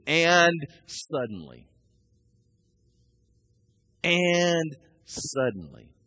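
long, high "and", then a falling "suddenly"; dramatic scripture reading. Acted. Preacher reading the angels' arrival, slow and suspenseful. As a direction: stretch it out slowly for suspense. Dramatic repeat of Bible verse.

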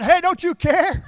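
hammed-up frantic shout at Jesus; mock indignant yell. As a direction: holler it at a sleeping friend. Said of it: "They said".

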